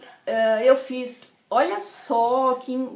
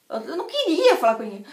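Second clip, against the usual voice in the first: plain reading voice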